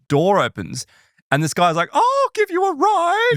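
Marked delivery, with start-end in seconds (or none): 1.9-3.3 very high, slow, eerie stranger offering a ride; a ghostly driver's high, strange voice; high-pitched strange voice. Retold or imitated. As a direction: float it up high and slow, unsettlingly friendly. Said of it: "this really high-pitched, strange voice".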